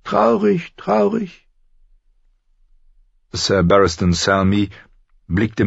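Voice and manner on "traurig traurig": much higher, oily feigned sorrow; mock-sad eunuch. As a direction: sigh it in false regret. (gespielt traurig, hoch)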